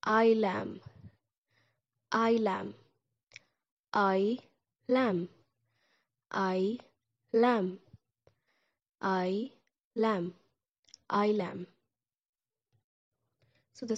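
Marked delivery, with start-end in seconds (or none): 0.1-2.7 "ailem" with a clear final m, ah-ee-lem; slow learner's "ailem"; Turkish ailem. Shown as a demonstration. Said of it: "then it sounds like". 3.9-11.7 "I lamb" split into its parts, I then lamb; syllable by syllable "I lamb"; teacher breaking down the word; broken into parts. Shown as a demonstration. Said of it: "then it sounds like".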